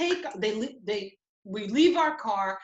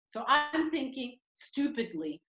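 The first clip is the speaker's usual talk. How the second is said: ordinary talk, nothing marked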